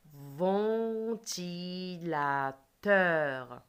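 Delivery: "Ventilateur" extremely slow, each syllable held long; "Ventilateur" in four long syllables for pupils to hear; stretched syllables. Shown as a demonstration. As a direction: draw out "Ventilateur" beat by beat. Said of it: "J'écoute et je dis la case".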